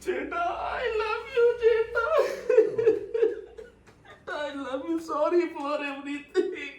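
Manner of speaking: put-on the boy sobbing his love to the stranger; crying. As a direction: cry through the thanks. Boy sobs thanks to stranger.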